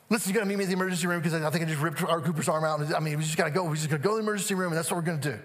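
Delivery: rattled off fast in one breathless rush; urgent rush. Retold or imitated. Dad calling his wife about the emergency room, rushed. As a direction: re-enact an urgent, rapid-fire phone call.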